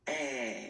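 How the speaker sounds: "eh" held wide and open, lingering on the vowel; open French è. Shown as a demonstration. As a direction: push a long, open "eh". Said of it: "so it goes like".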